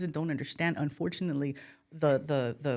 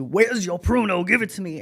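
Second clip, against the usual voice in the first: yelling grandpa; 3 semitones higher, 8 dB louder than usual. Retold or imitated. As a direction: holler like a cranky granddad demanding his stuff. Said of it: "like your grandpa yelling at you".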